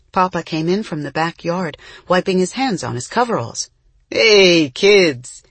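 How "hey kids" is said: loud, hearty call; booming. A narrator's reading. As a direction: boom it across the room. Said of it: "he boomed".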